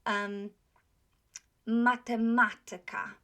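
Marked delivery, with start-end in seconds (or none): 1.7-3.2 "matematyka" with "ma" before "tyka" loud and held; antepenultimate stress. Shown as a demonstration. Teacher showing accent third from last, stressed "ma". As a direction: punch the second "ma" in "matematyka". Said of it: "syllable before tyka is accented".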